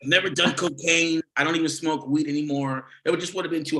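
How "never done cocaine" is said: usual speaking voice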